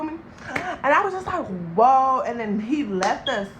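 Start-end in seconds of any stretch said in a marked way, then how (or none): 1.8-2.2 loud, surprised whoa; startled whoa. Retold or imitated. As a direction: react startled. Reaction quote.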